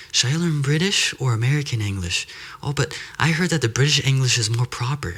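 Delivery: high-pitched mock earnest questioner; put-on voice of a wondering language learner; imitated Latin American learners. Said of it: "you'll get Colombians and Mexicans saying".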